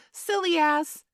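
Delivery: high, loud mocking shout. A narrator's reading. Tinker Bell shouting overhead before she hides. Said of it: "Tinker Bell shouted".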